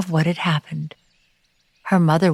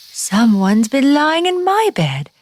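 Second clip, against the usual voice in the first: higher, slower mother bear voice; pitched-up mama bear, unhurried; middle-sized Mama Bear; 8 semitones higher, 4 dB louder, slower than usual. A narrator's reading. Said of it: "in her middle-sized voice".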